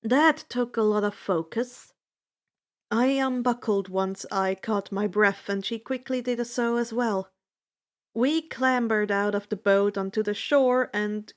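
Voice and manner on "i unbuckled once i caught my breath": usual speaking voice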